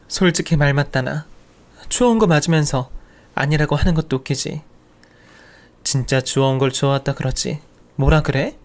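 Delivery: mocking, sneering aunt's jab; sneering mutter. Aunt jabs at departing nephew's back, sneering. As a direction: needle him with snide contempt. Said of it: "이죽거리듯 읊는 그 말에".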